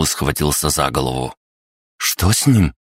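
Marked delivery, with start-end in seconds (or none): 2.0-2.7 higher, frightened cry. A narrator's reading. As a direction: cry out in alarm. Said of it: "испуганно вскрикнула Саманта".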